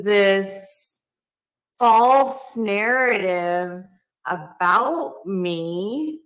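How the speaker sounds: dragged out slowly like a slowed clip; slowed playback. Shown as a demonstration. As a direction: stretch every word out slowly, slowed-down recording. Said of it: "I know you thought that was torture".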